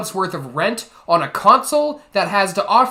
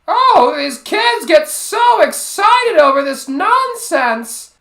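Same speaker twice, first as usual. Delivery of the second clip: high, loud, drawn-out mock mom whine; sighing, exasperated mother; 12 semitones higher, 9 dB louder, slower than usual. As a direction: complain slowly in a put-on mother voice. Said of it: "while sighing and going".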